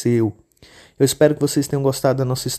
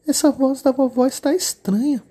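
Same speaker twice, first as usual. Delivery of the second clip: put-on much higher, childlike little girl voice; 12 semitones higher than usual. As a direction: play the innocent little girl. Menina desconfia. (menininha de voz fininha e aguda)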